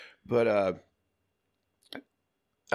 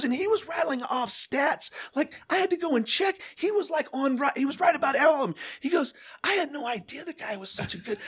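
Steve raving. Retold or imitated. Radio legend praising Nick to coworkers, high and impressed. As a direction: rave about the new guy, way up high.

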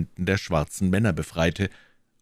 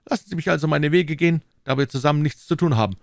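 quick, higher-pitched dismissal; a touch of impatient gentleman, hurried; lightly voiced stranger, impatient; 9 semitones higher, 3 dB louder, faster than usual. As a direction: brush them off briskly.

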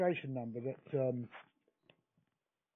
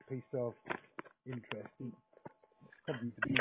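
speaking through a covered mouthpiece; muffled; 3 semitones lower, 6 dB quieter, faster than usual. Real, not acted. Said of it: "your line has gone very, uh, muffled again".

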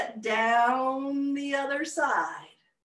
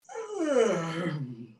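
sleepy sigh; 7 semitones lower than usual. Acted. Elephant drifts off to sleep.

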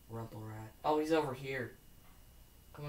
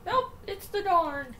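much higher, cartoonish squeaky voice; silly put-on voice; slower than usual. Joking to viewers about a wrecked rival, in a squeaky cartoon voice. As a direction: play a goofy high character announcing the wreck. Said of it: "The way that I can do voices".

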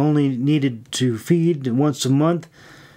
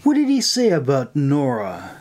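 curious; 6 semitones higher than usual. A narrator's reading. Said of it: "she pried curiously".

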